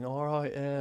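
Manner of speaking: a hint of an English accent. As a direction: quietly mimic his wee English accent. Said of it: "this wee English accent and stuff".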